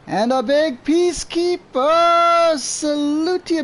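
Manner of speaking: high, slow, booming salute; crazy voice. Pretend. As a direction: stretch out the salute grandly. Greets second viewer, same catchphrase.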